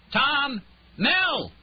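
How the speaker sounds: high, loud call for help; desperate shout. A narrator's reading. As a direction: scream their names downstairs. Calling out for help again.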